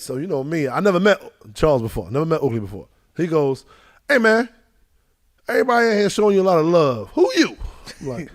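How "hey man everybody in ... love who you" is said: gruff questioning. Retold or imitated. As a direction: grill the stranger. Charles Oakley questioning him.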